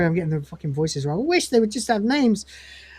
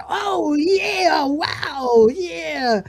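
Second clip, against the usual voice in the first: Cat impression; 9 semitones higher, much slower than usual. Retelling Cat's reaction in voice.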